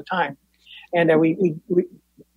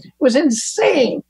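usual speaking voice

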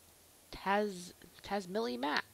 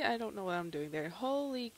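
ordinary talk, nothing marked

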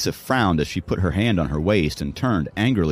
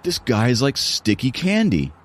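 higher-pitched, rattled racer's voice; threatened rival; 7 semitones higher than usual. A narrator's reading. Runner frets over rival catching up, threatened. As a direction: voice a runner unnerved by his challenger.

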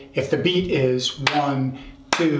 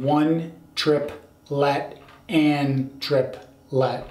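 slow, deliberate count; slower; much slower than usual. Shown as a demonstration. Teacher repeating the count slowly so students catch it, slow. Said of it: "I should say this slower".

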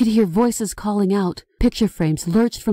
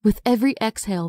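usual speaking voice

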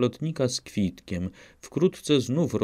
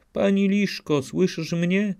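calling through the door; 9 semitones higher than usual. A narrator's reading. Kotka woła panią przez drzwi.

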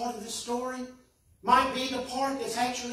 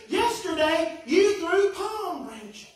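slow, higher, wounded complaint; hurt protest; 8 semitones higher, slower than usual. A narrator's reading. Donkey reproaching the crowd that ignored him. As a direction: plead that yesterday was different.

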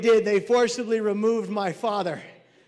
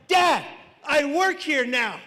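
loud, slow, higher protest; defiant retort; 5 semitones higher, 6 dB louder, slower than usual. Acted. Son tells dad off from the station, loud retort. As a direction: shout it back slowly, word by word.

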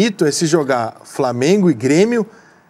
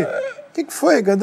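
slow, much higher puzzled ask; high drawn-out question; 10 semitones higher, slower than usual. Retold or imitated. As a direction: stretch it out high and bewildered. Careca asks what happened.

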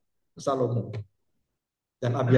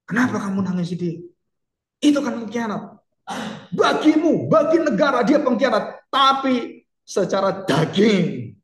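higher, louder indignant outburst; frustrated commander; 8 semitones higher, 4 dB louder than usual. Retold or imitated. Yoab scolding King David for weeping, higher and louder. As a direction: protest loudly, as if the king has lost his mind. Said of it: "Dan Yoab kecewa".

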